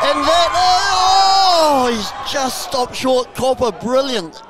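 high shouted call, drawn out then easing; excited play-by-play. Real, not acted. Commentator reacts to a run stopped short, in a drawn-out excited shout. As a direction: stretch the big cry, then ride it down slowly. Live call of near try.

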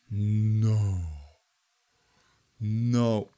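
low, drawn-out no; low groan of denial; disbelieving dismay. Real, not acted. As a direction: let it sink in, low. Disbelief at being killed.

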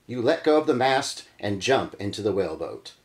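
usual speaking voice

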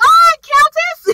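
high, loud cheering call; hyped cheer. Retold or imitated. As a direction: shout it like a fan. Cheering the character on.

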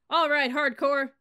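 slow, loud, high barking call; rally the troops at full volume; drill yell. Acted. Sergeant yelling to fire up his cadets. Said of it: "First Sergeant Stockel yelled".

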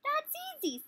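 tiny, very high confident inchworm. Acted. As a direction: chirp it up high like a small bug. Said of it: "said the inchworm".

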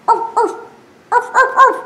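high yappy arf arf; dog barks. Acted. Dog barking at piglet, high yaps. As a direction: bark like a little dog.

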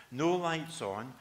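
normal talking voice

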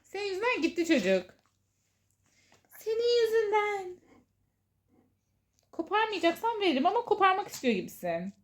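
high mock blame to a pet; talking to a dog. Real, not acted. Blaming the pet playfully. (köpeğe şakacı sitem)

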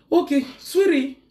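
high, slow and sweet; a slow, sweet okay; sweet reply. Real, not acted. Sweetly answering someone off-mic.

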